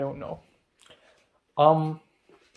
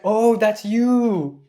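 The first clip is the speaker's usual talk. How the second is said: drawn-out, high, louder "oh" of realization; slow dawning recognition; 7 semitones higher, 7 dB louder, much slower than usual. Retold or imitated. Ken suddenly recognizing Enoch, slow surprised realization. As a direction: let it dawn on you slowly.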